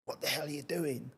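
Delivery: imitating the boss barking at him; aggressive boss. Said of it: "'Cause he was quite aggressive".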